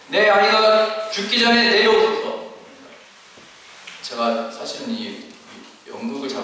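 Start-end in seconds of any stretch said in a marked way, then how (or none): 0.1-2.5 higher, louder, slow pleading voice; desperate plea. Acted. Official begging Jesus to come down, desperate pleading. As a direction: beg him to come before the child dies. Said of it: "이렇게 외쳤겠죠".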